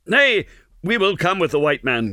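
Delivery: high frightened yelp falling into hurried assent; gasped. A narrator's reading. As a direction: gasp out surrender while being choked. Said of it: "gasped the man".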